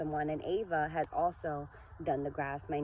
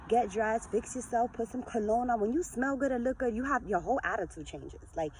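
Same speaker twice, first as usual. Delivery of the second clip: normal talking voice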